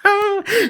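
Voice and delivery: shrill burst of delighted laughter; gleeful high cackle. Acted. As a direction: laugh for joy. Sheriff laughs for joy.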